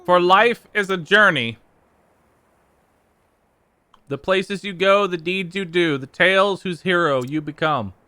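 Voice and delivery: grand narration. Acted. Reads the closing narration grandly.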